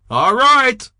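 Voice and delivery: loud, high yelled signal. Acted. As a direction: bark the order across the street. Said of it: "the voice yelled".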